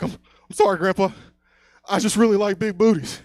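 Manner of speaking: high, loud stammering plea; mock frightened apology. Grandson caught by grandpa confesses, mock frightened pleading. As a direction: whimper it like a caught boy, hammed-up.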